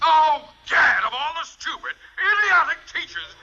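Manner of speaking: high, loud blustering rage; furious high-pitched shout; exasperated outburst. Acted. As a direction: explode at the incompetence. Principal explodes at the crossed line.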